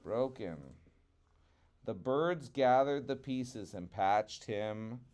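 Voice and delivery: ordinary delivery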